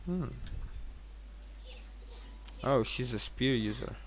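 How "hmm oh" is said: ordinary talk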